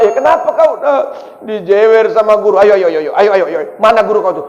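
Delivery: loud, indignant hammed-up mum; mock furious mother demanding the teacher; outraged mother. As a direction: storm off to confront the teacher.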